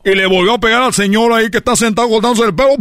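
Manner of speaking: ordinary talk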